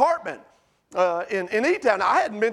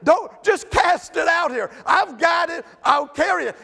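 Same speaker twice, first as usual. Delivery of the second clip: louder and higher, a mock-eager yell; 5 semitones higher, 4 dB louder than usual. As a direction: shout it out like you're begging to take the load. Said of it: "he would have been yelling at the husband".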